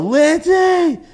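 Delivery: high, loud yell for help; calling for help, high and loud; yelling a name. Retold or imitated. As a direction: shout her name from the kitchen. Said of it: "And I yell".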